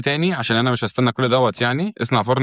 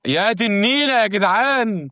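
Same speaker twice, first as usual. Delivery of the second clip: exasperated; 8 semitones higher, slower than usual. Real, not acted. Frustrated while building, drawn out.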